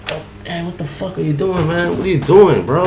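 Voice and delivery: mock-startled what the hell; confronting. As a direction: demand what he's doing. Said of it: "And I'm like".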